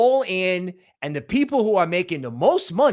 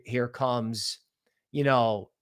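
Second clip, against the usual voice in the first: ordinary talk, nothing marked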